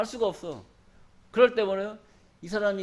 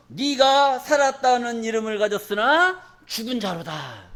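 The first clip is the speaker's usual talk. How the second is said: slow solemn recitation; 2 semitones higher, 3 dB louder, slower than usual. Acted.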